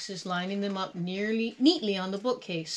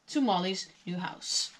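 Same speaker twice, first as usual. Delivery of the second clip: usual speaking voice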